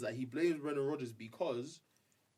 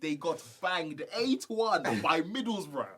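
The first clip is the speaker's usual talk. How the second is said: mocking emphasis; 5 semitones higher, 7 dB louder, slower than usual. Real, not acted. Mocking rival club.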